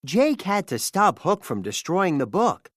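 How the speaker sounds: ordinary delivery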